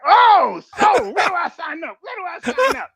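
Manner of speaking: high, loud acted-out eagerness; eager outburst. Young man to Marine recruiter after the pitch, excited mock shout. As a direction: blurt it out high like an instant convert.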